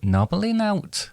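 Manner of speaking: high, slow Welsh accent. Shown as a demonstration. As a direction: say it slowly in a high Welsh lilt. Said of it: "going on to now Welsh".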